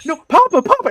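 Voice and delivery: high, loud whine of protest; daughter's protest. Retold or imitated. Daughter stopping Dad's joking, high-pitched protest. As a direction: imitate an embarrassed kid objecting.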